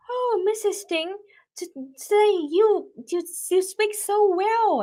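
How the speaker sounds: a high-voiced villager marveling; admiring villager. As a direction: gush over how well she spoke. Villagers praise the old lady.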